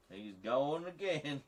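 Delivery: slow, high, loud Southern drawl; hammed-up Southern accent, stretched out. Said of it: "doing one of those deeply offensive Southern stereotype accents".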